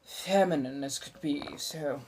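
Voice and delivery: put-on a lightly held eighteenth-century colonial American accent; a mild colonial American accent, chatty and theatrical. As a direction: keep the old colonial American lilt going as you chat. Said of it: "the accent is, uh, roughly seventeen hundreds colonial American".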